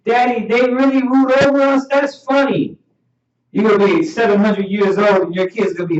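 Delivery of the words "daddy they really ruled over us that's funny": lightly voiced child, pitched higher; children's reaction. Children to their father about old rulers, higher-pitched amused disbelief. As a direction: ask like an amused, disbelieving kid. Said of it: "Our children are gonna look at him and be like".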